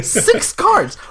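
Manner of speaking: "Six cards" cried out in amazement; reading in disbelief. Retold or imitated. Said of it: "I was like".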